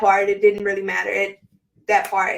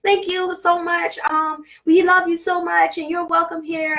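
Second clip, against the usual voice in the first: put-on high, light children's thank-you voice, much higher than her own; grateful children; 8 semitones higher than usual. Children thanking her in the dream vision, high and gushing. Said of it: "the kids were all coming up to me, hugging me".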